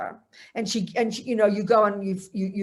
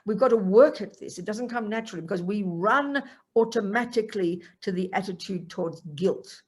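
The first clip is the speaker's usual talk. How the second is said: ordinary talk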